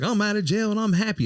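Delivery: high-pitched imitation of a gleeful man. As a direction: mimic his happiness way up high. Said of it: "[name] is like".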